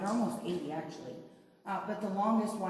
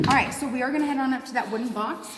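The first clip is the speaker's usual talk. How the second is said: raised voice calling the tour on; calling out to group; 6 semitones higher, 8 dB louder, faster than usual. Real, not acted. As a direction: call the group up ahead. Guide calling group onward.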